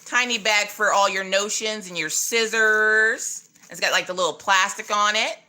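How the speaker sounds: normal talking voice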